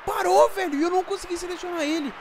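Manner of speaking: high, loud frantic shouting; frantic yelling. Real, not acted. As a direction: scream at the screen in panic. Frantic attack, then frustration.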